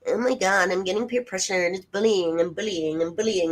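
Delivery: high, loud whining imitation; mocking her bullying complaint; whiny mock victim. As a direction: whimper like a wronged child. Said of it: "So then she goes".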